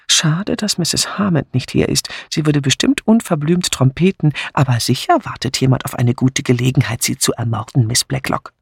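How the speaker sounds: ordinary delivery, nothing marked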